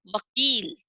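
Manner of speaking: "वकील" opening on a clear va; "वकील" pronounced carefully with a v start; model व word. Shown as a demonstration. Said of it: "तो क्या बोलेंगे इसको".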